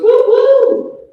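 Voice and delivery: high, loud mock catcall; hammed-up catcaller woo; catcaller hoot. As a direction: hoot like a construction worker. Catcaller hooting at her.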